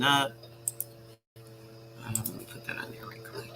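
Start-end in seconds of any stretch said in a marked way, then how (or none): 2.0-3.5 whispering. Real, not acted. Aside while typing in chat.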